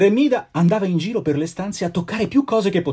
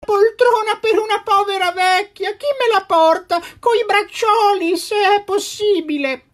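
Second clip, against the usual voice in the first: put-on old woman pleading. A narrator's reading. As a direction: beg for a chair like a frail old woman. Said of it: "implorava una vecchiettina". (vecchietta che implora, voce molto acuta e lenta)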